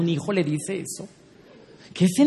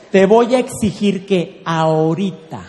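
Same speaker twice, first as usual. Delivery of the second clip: slow, deliberate ultimatum; emphatic demand; 4 dB louder than usual. Shown as a demonstration. Coach modelling wife's ultimatum, slow and firm. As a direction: draw out the demand for impact. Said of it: "pero tiémblele".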